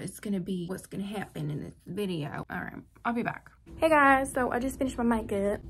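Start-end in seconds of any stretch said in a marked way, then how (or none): 3.8-5.6 put-on Southern accent. Vlogger greeting her viewers in a mock Southern accent. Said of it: "God, I gotta stop doing that accent".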